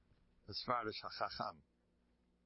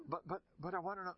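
acted insistent pleading; 8 semitones higher, faster than usual. Husband begs rabbi to tell.

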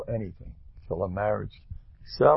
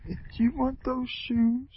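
much higher, softer coaxing mother; doting mother; 3 dB quieter than usual. Retold or imitated. Mother asking her little child about shoes, high coaxing. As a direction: coo at the child like a pushy-sweet mom. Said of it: "the mothers come big".

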